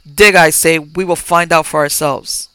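forceful shouted order; loud command. Acted. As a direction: override him with a firm command. Officer overriding landlord.

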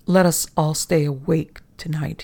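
plain reading voice

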